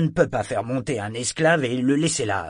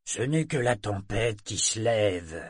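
ordinary delivery, nothing marked

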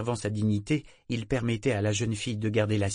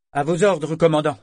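loud, higher formal reply; crisp report; 7 semitones higher, 9 dB louder than usual. A narrator's reading. Sailor reporting to her captain, loud and formal. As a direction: snap to attention.

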